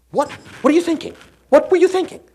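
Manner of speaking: high, loud disbelief that climbs; exasperated outburst. Acted. Someone aghast at a colleague's mistake, loud and high. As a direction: throw the question up in exasperation. Kinesthetic person exasperated, hand to head.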